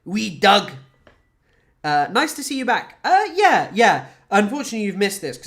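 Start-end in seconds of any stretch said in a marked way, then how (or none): 0.1-0.7 Scottish accent, a touch louder; playful Scottish accent. Shown as a demonstration. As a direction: say Wee Doug like a Scot. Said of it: "Scottish people refer to dogs as Doug".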